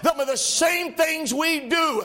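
rising, shouted gospel preaching; preaching at full force. Acted. Preacher to his congregation, shouting, fervent. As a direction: lift the congregation with fiery, shouting delivery.

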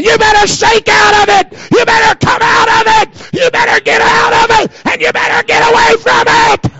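loud revival preaching, near to tears; full-force preaching shout. Real, not acted. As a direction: cry it out over the church like a fiery revival preacher. Preacher exhorting the congregation.

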